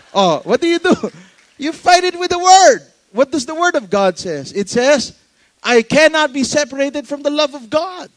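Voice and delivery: loud, high, forceful preaching. Acted. Preacher rallying the congregation to fight with scripture, loud and high. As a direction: proclaim it to the whole church with full force.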